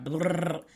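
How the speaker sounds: a quick mock blabbering noise, "blrr"; jargon babble. Shown as a demonstration. Coach mimics her jargon talk that drives people off, mock babble. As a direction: blurt a nonsense babble sound. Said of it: "just blabbing off".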